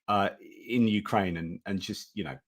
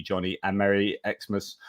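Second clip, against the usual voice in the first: normal talking voice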